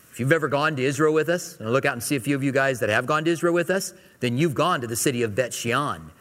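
normal talking voice

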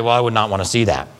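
low, quick mock-prim reply; mock congregation member. Preacher imitates a churchgoer who refuses to watch, low and quick. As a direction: voice a squeamish churchgoer, low and fast. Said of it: "Some of you guys here say".

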